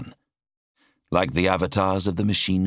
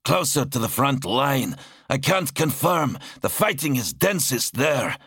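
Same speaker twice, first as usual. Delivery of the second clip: higher-pitched soldier voice; higher character voice; 7 semitones higher than usual. Acted. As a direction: answer crisply in a higher voice. Zar voiced higher in narration.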